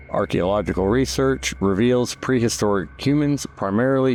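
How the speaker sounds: measured, informative guided-tour read; documentary narration. Acted. Documentary narration over cave footage.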